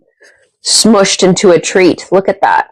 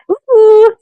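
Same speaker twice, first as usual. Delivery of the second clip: excited cheer; 13 semitones higher than usual. Real, not acted. Cheering a crunchy chip.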